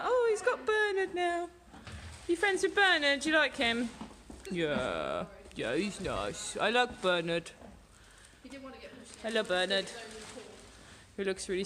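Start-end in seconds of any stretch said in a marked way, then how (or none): 0.0-3.9 high, put-on voice asking about a new friend; voicing the other horse. Horse asking the new horse about his friend Bernard, high and sweet. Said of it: "He's like". 4.5-7.5 low, slow horse voice, mildly shy; voicing the horse. Horse answering about his new friend Bernard, low and slow. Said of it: "He's like".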